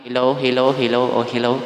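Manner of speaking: mic test at full volume. Shown as a demonstration. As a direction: test a mic that is turned all the way up. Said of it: "So, i-full natin".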